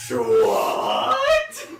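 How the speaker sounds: high, loud shocked shout; shocked what. Pretend. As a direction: yell it in shock. Acting surprised character.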